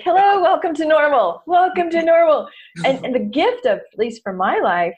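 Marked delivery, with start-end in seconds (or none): 0.0-2.5 high, loud sing-song hello; sarcastic bright welcome; mock cheery greeting. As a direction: greet the dreary morning with fake brightness.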